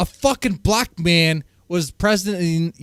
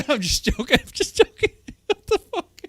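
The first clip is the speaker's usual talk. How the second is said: laughing; 7 semitones higher than usual. Real, not acted. Laughing at own joke.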